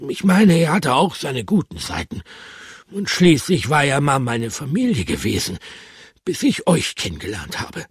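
hohe, wehmütige Hamsterstimme; wehmütiger Hamster. Acted. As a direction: kleiner trauriger Hamster, hoch gesprochen. Hamster Bertram voiced in story. (hohe Hamsterstimme voller Heimweh)

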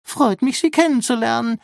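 very high, polite character voice; squeaky little robot. A narrator's reading. Rambo greets the offered hand, high and squeaky. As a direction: pipe it up like a tiny machine.

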